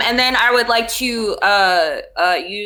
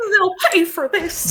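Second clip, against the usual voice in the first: vengeful threat; 12 semitones higher than usual. Acted. Character vows revenge mid-battle.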